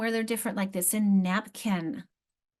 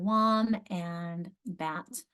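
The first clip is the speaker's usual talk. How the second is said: "wom and bat" drawn out very slowly, each syllable apart; slow syllable split; much slower than usual. Shown as a demonstration. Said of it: "practice reading that word".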